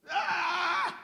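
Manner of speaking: loud, high wordless yell; vocerón. Retold or imitated. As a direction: let out a booming prayer cry. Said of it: "Yo ese vocerón". (grito fuerte y agudo al orar)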